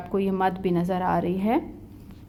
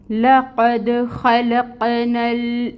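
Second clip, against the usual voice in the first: slow melodic tajweed chant; Quranic recitation; 4 semitones higher, 5 dB louder, much slower than usual. Shown as a demonstration. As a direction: recite slowly, drawing out every long vowel. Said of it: "آیہ مبارکہ کو دوبارہ پڑھ کر دیکھتے ہیں". (تجوید کے ساتھ آہستہ سریلی تلاوت)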